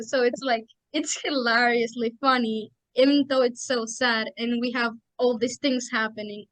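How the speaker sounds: normal talking voice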